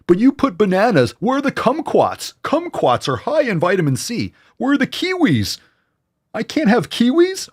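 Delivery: high, whiny nitpicking voice; whiny critic. Retold or imitated.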